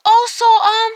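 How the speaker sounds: high, hesitant imitation of the doctor; mock doctor. Doctor sits down and guesses at diagnosis, high mocking imitation. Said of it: "He just sits there and he goes".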